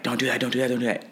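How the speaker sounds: high, contained mock yell; repeated urgent warning without real volume; imitated yelling. Fighter imitating a friend yelling at him cageside, contained mock yell. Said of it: "yelling at me".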